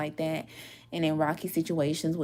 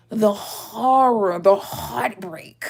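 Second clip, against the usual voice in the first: slow, hushed melodrama; drawn-out theatrical whisper; mock tragic; 2 semitones higher, 5 dB louder, much slower than usual. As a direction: ham up the heartbreak like a tragedy. Dramatising mother's heartbreak.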